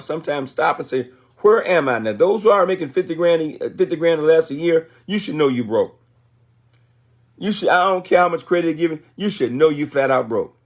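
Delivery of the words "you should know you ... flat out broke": ordinary talk, nothing marked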